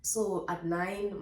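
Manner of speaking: normal talking voice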